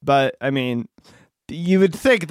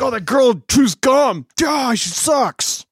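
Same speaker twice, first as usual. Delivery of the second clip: higher, slow sneering grandparent; judgy grandparents; 9 semitones higher, slower than usual. Retold or imitated. Mocking the grandparents judging a gum-chewing girl, slow sneer. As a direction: drag out the disdain in a put-on old voice. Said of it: "or like".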